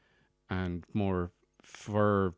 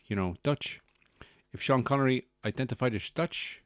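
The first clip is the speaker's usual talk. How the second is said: hammed-up Dutch accent with slushy s sounds. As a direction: Sean Connery-style slurred Dutch accent. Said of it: "this is how he would sound".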